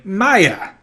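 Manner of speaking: high, loud magic-word yell. A narrator's reading. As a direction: shout to kickstart the flying sneakers.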